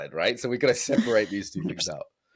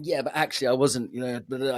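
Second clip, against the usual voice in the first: quick defensive pushback, pitched up; defensive protest; 4 semitones higher, faster than usual. Retold or imitated. Boyfriend brushing off his girlfriend's feedback, defensive. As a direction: protest that you weren't, a bit rattled. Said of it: "I get defensive".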